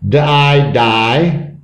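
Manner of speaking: D, I, then blended die; sounded out. Shown as a demonstration. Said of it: "This Y E sounds like I".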